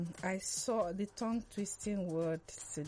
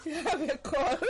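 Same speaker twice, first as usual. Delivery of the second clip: high, loud real laughter; can't stop laughing; laughing fit; 7 semitones higher, 6 dB louder than usual. Real, not acted.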